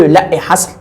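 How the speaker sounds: usual speaking voice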